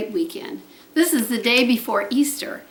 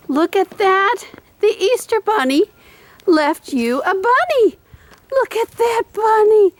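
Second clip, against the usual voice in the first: dog talk; 8 semitones higher than usual. Real, not acted. Praising dog's found bunny toy.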